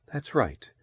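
soft husky woman's reply. A narrator's reading. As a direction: answer quietly, with a husky throb. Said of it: "Her voice was low and husky".

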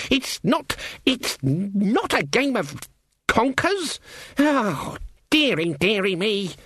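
put-on high, exasperated old-witch voice; indignant Granny witch. Granny objecting that witchcraft is no game, indignant. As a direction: sputter in disapproval, pitched well up.

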